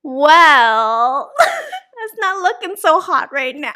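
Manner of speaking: laughing, high and loud. Real, not acted. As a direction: laugh through the words. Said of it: "laughing at her dating life".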